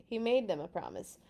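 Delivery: ordinary delivery, nothing marked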